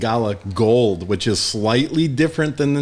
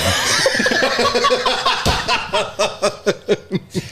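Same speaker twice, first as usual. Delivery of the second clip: laughing; 8 semitones higher than usual. Real, not acted. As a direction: laugh hard at the taste. Laugh after tasting.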